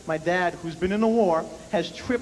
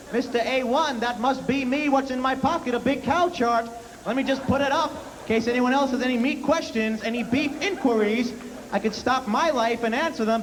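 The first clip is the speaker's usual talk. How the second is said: high, mocking sarcastic father; dad sneering about being a steak expert; 6 semitones higher than usual. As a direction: drip sarcasm as the offended dad.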